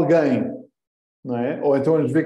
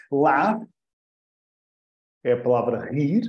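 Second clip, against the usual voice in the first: usual speaking voice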